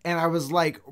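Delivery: usual speaking voice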